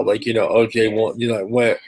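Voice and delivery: ordinary delivery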